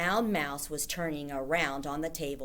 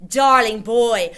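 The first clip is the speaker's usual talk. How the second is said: slow, high, loud sugary witch voice; syrupy witch coo; 5 semitones higher, 12 dB louder, slower than usual. A narrator's reading. Grand High Witch cooing to lure Bruno. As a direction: coo it with false sweetness. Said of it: "cooed the Grand High Witch".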